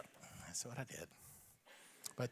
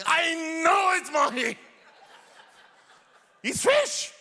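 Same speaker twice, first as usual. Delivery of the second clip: much higher, louder, slow indignant yell; exasperated shout; 11 semitones higher, 5 dB louder, slower than usual. Retold or imitated. Dad yelling at the waiter that mahi is fish, exasperated shout. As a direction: blow up at the waiter.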